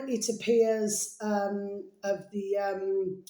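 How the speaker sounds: ordinary delivery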